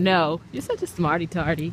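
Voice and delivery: usual speaking voice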